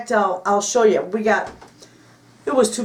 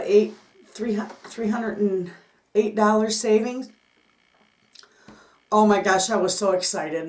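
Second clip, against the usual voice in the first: normal talking voice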